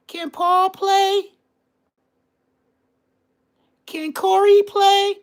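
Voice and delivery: put-on very high, slow falsetto of a young boy; little kid voice. Kid at a friend's door asking to play, high child voice. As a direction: call out like a kid before his voice broke. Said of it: "In that voice. Balls was not dropped".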